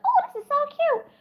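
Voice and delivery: high, cutesy gushing voice; mock girly gush. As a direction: coo like a smitten girl over butterflies.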